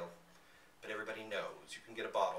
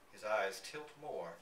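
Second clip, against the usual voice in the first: usual speaking voice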